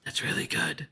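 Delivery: breathy whispered relish; hushed mocking whisper of delight. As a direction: whisper it like savouring a taste. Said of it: "he whispered".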